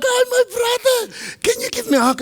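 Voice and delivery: high, sugary mock pastor greeting; sweet pastor greeting. Pastor greets the angry guest with high sweetness. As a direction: sing it out, all sweetness, very high. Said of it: "you go like".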